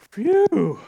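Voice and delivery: high, loud whoosh of relief; relieved "phew". Retold or imitated. Father hearing his daughter is heading home, big relieved phew. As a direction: let out a big acted sigh of relief.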